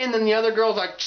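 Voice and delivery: usual speaking voice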